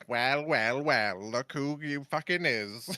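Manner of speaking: slow, nasal Sylvanas sneer. Retold or imitated. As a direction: sneer it through the nose. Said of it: "in her super nasally voice".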